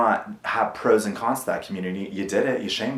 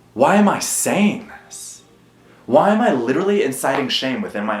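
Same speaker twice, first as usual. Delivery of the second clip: exasperated; 5 semitones higher, 4 dB louder than usual. Real, not acted. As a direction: ask it in real disbelief. Rhetorical self-questioning.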